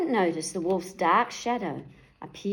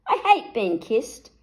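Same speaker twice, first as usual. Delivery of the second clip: disgusted; 4 semitones higher, 5 dB louder than usual. A narrator's reading. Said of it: "spluttered the big bad wolf".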